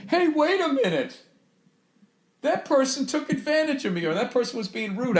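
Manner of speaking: high, soft put-on voice of his own hindsight; mock belated realization. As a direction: squeak it quietly, as though it only just dawned on you. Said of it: "I'd be like".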